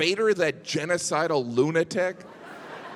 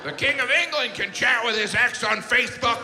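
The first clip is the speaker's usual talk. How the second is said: whiny ex-king; 7 semitones higher than usual. Retold or imitated. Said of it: "he's like".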